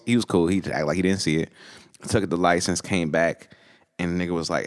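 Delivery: ordinary delivery, nothing marked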